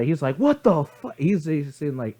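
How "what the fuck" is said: high-pitched shocked exclamation; mock disbelief. Time traveler hearing what year it is, mock shocked. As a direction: blurt it high, acting floored.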